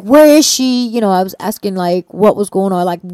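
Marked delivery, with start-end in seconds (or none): 0.0-0.9 higher and louder, frantic question; urgent panicked ask. Retold or imitated. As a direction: demand an answer in panic. Frantic question about mom.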